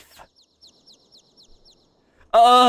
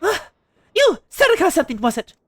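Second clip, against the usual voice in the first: higher, lively voice of the bold mother; playful wife; 9 semitones higher, faster than usual. Acted. Streamer voicing the Manderville wife, high and teasing. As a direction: sweep through her lines bright and teasing.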